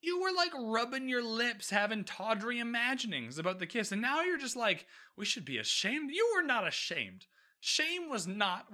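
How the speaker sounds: exasperated mock rant at Kate; exasperated disbelief. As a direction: call her out, incredulous. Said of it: "And I was like".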